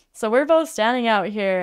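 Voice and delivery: normal talking voice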